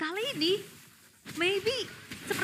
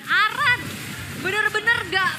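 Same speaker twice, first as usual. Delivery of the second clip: excited; 6 semitones higher, 7 dB louder than usual. Real, not acted. Caster hyping a play.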